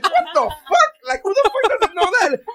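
a comic mocking a cohost's fake praise, high incredulous outrage; incredulous outburst. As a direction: throw up your hands at the patronizing praise. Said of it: "And I'm like".

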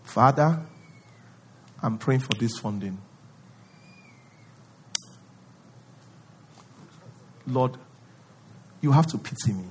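low, soft self-pitying plea; mock pitiful prayer, low and soft; victim prayer. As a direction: whine to God like a victim. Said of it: "praying like a victim".

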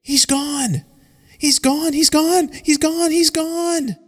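high, loud, desperate repeated cry; frantic cry. Acted. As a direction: cry out again and again in panic. Companion vanished, frantic cry.

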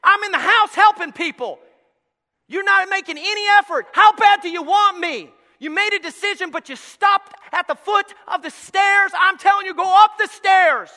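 loud, slow, high calling; God pleading with his people. Acted. God calling the lazy believer, loud and slow. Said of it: "And God's going".

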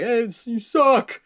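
high, drawn-out mock heckle; slow, high-pitched imitated taunt; drunk heckler. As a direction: call out a jeer like a drunk in the crowd.

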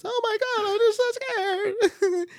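very high, tiny scared pillow talk voice. Pretend. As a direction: coo it in a squeaky scared voice. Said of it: "that's my pillow talk voice".

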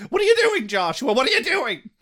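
high mock mom nagging; scolding mom. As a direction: press him like a fussing mother.

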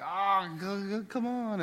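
exasperated shooing. Retold or imitated. Dad shooing kids to bed.

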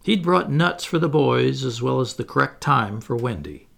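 ordinary delivery

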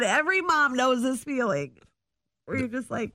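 ordinary delivery

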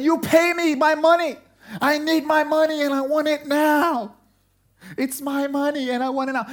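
furious servant shouting to be paid; angry demand. Acted. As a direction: seize him by the throat and demand it. Servant choking fellow servant, demanding.

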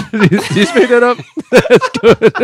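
can barely get it out for laughing. Real, not acted. Cracking up at a friend's made-up title, laughing out the words.

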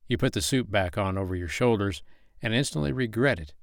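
ordinary delivery, nothing marked

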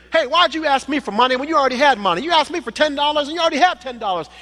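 high, fast scolding; angry father. Pretend. Father catching son with money, high and fast scolding. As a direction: scold him for lying. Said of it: "getting angry again".

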